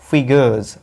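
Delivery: फिगर्स as FI-guhz, voiced z ending; first-syllable stress. Shown as a demonstration. Teacher shows where the stress falls, फिगर्स as FI-guhz. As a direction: stress the start of फिगर्स and buzz the end. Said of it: "last में जेड है और पहले वाले".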